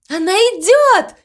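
high, excited cry; excited calling out. A narrator's reading. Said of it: "крикнула мама Му". (радостный высокий крик)

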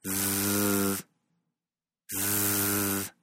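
a long voiced zzz; buzzing z. Shown as a demonstration. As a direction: hold a buzzing zzz in the throat. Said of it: "Di zzz". (zzz sonora y prolongada)

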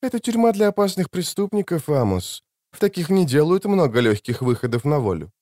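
high, calm, slightly indistinct woman's voice; calm, slightly slurred. A narrator's reading. Injured woman explaining the prison to Amos, calm and faintly slurred. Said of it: "Голос Клариссы был спокойный, но слегка невнятный".

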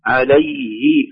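usual speaking voice

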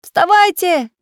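high, loud wake-up call; shouting. Acted. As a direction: yell to rouse everyone. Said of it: "закричал Самоделкин".

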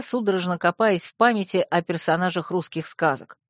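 ordinary delivery

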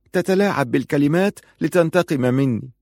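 high-pitched, lightly acted woman's protest; wounded accusation. Rashida suspects her cousin of taking revenge, wounded and accusing. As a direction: accuse him, pitch lifted.

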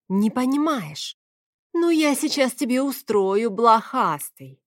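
acted angry scolding; wave of anger. A narrator's reading. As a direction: threaten him, drawing out the insult. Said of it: "меня накрыло волной гнева".

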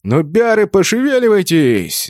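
rough-and-ready high-pitched hurry-up yell. A narrator's reading. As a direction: yell at stragglers to move. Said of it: "заорал сзади берсерк".

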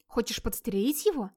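usual speaking voice

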